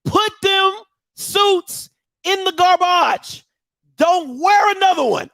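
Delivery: high, emphatic, drawn-out telling-off; comic scolding. Pretend. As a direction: scold him in mock exasperation. Comic scolding of Hugh over suits.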